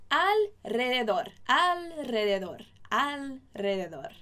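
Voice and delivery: slow, carefully sounded "alrededor"; pronunciation drill. Shown as a demonstration. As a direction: say "alrededor" slowly, each sound distinct for learners to copy. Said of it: "Vamos treinar essa pronúncia".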